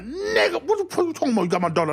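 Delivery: high, outraged cry at the caller; outraged father. Retold or imitated. Imagined father's angry reply.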